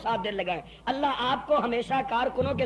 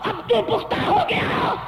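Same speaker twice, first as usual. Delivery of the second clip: gloating shout; 10 semitones higher, 4 dB louder, slower than usual. Acted. Umayya gloating over the deal.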